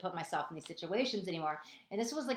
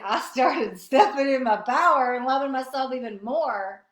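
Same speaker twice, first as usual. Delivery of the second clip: laughing; 3 semitones higher, 9 dB louder than usual. Real, not acted.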